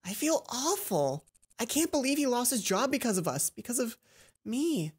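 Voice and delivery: Mai blaming herself; guilty Mai. Acted.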